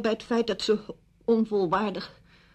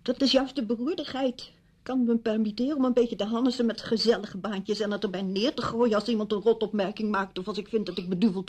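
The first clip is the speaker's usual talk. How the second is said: heated, higher-pitched protest building in force; indignant outburst; 3 semitones higher than usual. Acted. Widow protesting about pointless little jobs, indignant. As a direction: pour out the frustration, voice pitched up.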